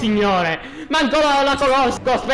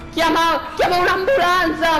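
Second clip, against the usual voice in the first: mock urgent call; 7 semitones higher than usual. Calling for help for fish.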